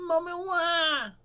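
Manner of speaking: put-on high drawn-out toddler wail; wailing little Richard. A narrator's reading. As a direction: cry out for mommy in a high, slow whine. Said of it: "says Richard".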